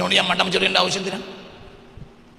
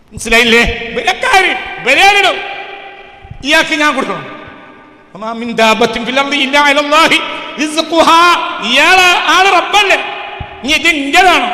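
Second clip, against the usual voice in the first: loud, high-pitched preacher's shout; full-force preaching; 8 semitones higher, 8 dB louder than usual. Real, not acted. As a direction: thunder it at the congregation. (ഉച്ചത്തിൽ അലറിയുള്ള പ്രസംഗം)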